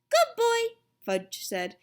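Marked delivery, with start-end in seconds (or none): 0.1-0.7 put-on high, loud toddler voice; little boy. A narrator's reading. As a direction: chirp it like a tiny child. Toddler Fudge answers mother.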